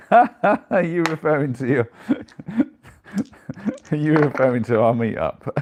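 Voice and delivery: high, giggling through the words; laughing question. Real, not acted. Teasing Sue in chat about the soiree, laughing. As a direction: ask it while laughing.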